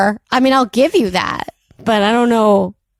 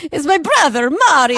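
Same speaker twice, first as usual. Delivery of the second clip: higher cartoon Italian voice; mock Italian accent; 6 semitones higher than usual. Co-host joins the host's mocking, cartoon Italian. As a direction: join in with a hammed-up Italian accent. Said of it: "It is Jared Leto".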